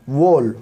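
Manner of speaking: "वोल" starting with a sounded W; W wrongly sounded. Shown as a demonstration. Teacher shows learners the wrong reading, "वोल" with W voiced. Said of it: "डब्ल्यू को नहीं निकालेंगे हम".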